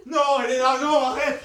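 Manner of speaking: pleading shout. Acted. Lover pleading in acted quarrel.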